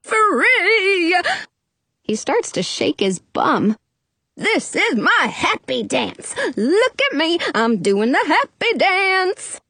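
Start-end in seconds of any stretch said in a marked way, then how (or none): 0.0-1.1 high, drawn-out whoop; exultant cry. A narrator's reading. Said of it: "the genie cries". 4.4-9.6 gleeful genie. A narrator's reading. Genie dances, celebrating his freedom.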